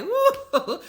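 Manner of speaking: teasing imitation of a high woo; high excited woo. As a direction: mimic a giddy little cheer. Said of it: "she just is like".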